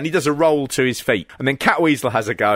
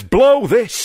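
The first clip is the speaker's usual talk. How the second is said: mock outburst; 8 semitones higher, 4 dB louder than usual. Quoting wrestler's fed-up outburst.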